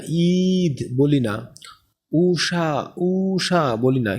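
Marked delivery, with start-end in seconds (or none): 0.1-1.0 long drawn-out ঈ in ঈদ; টেনে পড়া. Shown as a demonstration. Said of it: "টেনে পড়া হয় না". 2.1-3.8 both vowels of উষা drawn out long; টেনে পড়া. Shown as a demonstration. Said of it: "টেনে পড়া হয় না".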